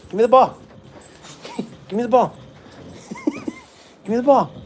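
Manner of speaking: loud, high call to the dog; playful demand. Real, not acted. Man playing fetch with his dog, playful demand. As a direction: demand it in play, voice jumping up.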